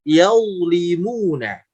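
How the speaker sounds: "yaulimuna" slowly, first syllable dark "yau". Shown as a demonstration. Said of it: "naik pangkal suaranya". ("yaulimuna" pelan, awalnya tebal)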